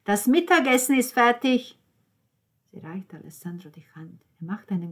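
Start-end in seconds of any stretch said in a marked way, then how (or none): 0.1-1.8 loud, high call to the table. A narrator's reading. Father calls everyone in for lunch, calling loudly. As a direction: holler it through the house. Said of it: "Dann hörte sie ihren Vater rufen".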